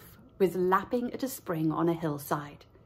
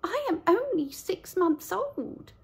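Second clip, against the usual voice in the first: high, slow meek lamb; a small lamb pleading; 9 semitones higher than usual. Acted. Lamb answers.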